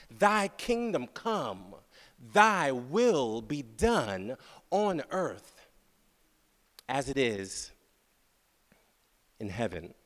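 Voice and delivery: slow, hushed, fading to a whisper; reverent recitation. Acted. Preacher savoring the prayer line to the church, slow and hushed. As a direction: savor each word of the prayer.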